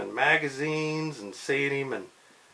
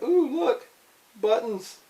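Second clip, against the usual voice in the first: put-on high, loud childlike delight; delighted ooh; 12 semitones higher, 5 dB louder, slower than usual. Spidey spots buttons and coos in high delight. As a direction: coo over the buttons like a kid.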